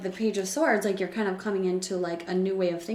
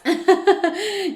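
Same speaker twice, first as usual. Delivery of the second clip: bright laugh; 12 semitones higher, 10 dB louder than usual. Real, not acted. Laugh after excited yeses.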